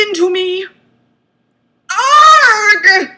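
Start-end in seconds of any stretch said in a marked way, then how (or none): 1.9-3.1 hammed-up loud yelp, pitched up; over-the-top pained yelp; mock cry of pain. A narrator's reading. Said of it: "I cry".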